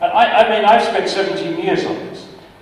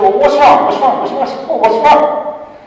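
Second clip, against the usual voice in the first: imitating the colleague, higher and louder with concern; alarmed colleague; 10 semitones higher, 7 dB louder than usual. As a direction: play the worried colleague asking what's wrong, pitched up. Retold colleague's alarmed questions.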